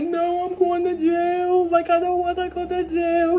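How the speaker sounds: high, slow, wailing mock sob; imitating crying friend. Friend voiced crying, afraid of jail. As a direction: whimper it like a scared kid.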